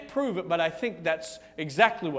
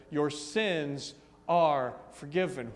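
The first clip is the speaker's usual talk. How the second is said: tender; 5 semitones lower, slower than usual. Acted. Jesus gently speaking to the woman.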